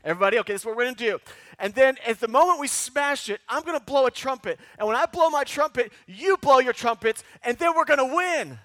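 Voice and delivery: a hint of an eager Gideon rallying his men; mock battle plan. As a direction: pitch the crazy plan with gusto. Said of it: "He's gonna say".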